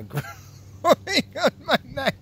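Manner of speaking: high, laughing through the words; broken up by high laughter. Real, not acted. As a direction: crack up while saying it. Cracks up mid-sentence at himself.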